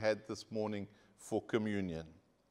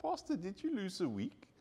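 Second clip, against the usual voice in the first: soft, high-pitched gentle question; imitating a congregant's sweet little message; sweet inquiry; 6 semitones higher, 5 dB quieter than usual. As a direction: ask it sweetly, high and softly. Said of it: "sent me a sweet little message".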